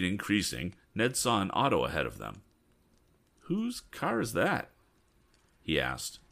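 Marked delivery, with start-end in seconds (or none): 3.4-4.7 high, slow wondering question; curious Ned. Acted. Ned asks Tom about the car ahead, high and slow. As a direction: draw the question out, peering ahead.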